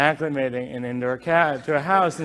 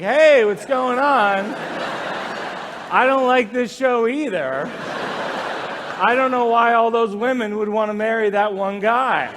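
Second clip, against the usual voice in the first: higher, casual little mouse voice; Stuart Little; 4 semitones higher than usual. Retold or imitated. Mouse chatting to wife on couch, higher casual voice. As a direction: play a friendly mouse chatting away. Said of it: "looked up at her like Stuart Little".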